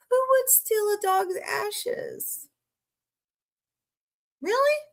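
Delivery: high, slow disbelief; incredulous outrage. Real, not acted. Streamer reacting to a news headline, high incredulous outrage.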